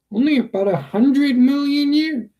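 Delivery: pitched high, a know-it-all snap to Dad; smug correction. A narrator's reading. Boy correcting his father, smugly high and snide. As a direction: sneer out the fact like a smug kid.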